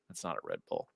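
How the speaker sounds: low, soft flat-voiced warning; deadpan warning. Imitating a friend stopping him from drinking, low soft deadpan. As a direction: drop the voice and deliver it dryly. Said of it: "he's like".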